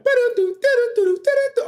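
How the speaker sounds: high, loud mouthed horn riff; horn imitation. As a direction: imitate blaring horns in a high voice. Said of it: "horns going off, like".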